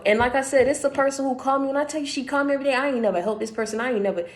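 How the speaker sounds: usual speaking voice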